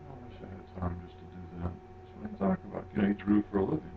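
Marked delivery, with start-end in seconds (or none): 0.1-1.7 lightly voiced tired pro, softer; a hint of a weary working artist; quoting a weary animator. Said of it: "his comment was". 1.7-2.8 quoting the animator. Retold or imitated. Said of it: "his comment was".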